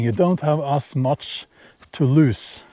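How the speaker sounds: usual speaking voice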